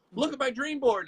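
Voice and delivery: high, proud, showing it off; eager dream chaser; excited. Retold or imitated. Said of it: "Lots of people are like".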